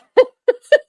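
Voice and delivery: squealing high laugh; high burst of laughter. Real, not acted. Guest recalling the man's claim, high burst of laughter.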